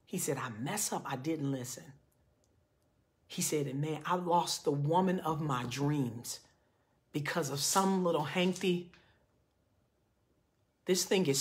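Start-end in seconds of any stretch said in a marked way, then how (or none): 0.4-1.9 low, hushed admission of fault; almost in tears. Retold or imitated. Man admits he didn't listen, retold as almost in tears. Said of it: "he's almost in tears". 3.7-8.9 soft, drawn-out regret, retold; almost in tears. Man laments losing dream woman, retold as almost in tears. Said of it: "he's almost in tears".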